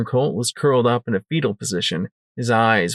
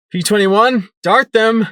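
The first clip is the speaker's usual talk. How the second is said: urgent order; 10 semitones higher, 6 dB louder than usual. Acted.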